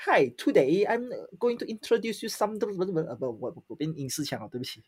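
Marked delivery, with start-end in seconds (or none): 0.1-2.6 imitating an American presenter, higher; today I'm going said in a mock American accent. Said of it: "那种很American style的那种".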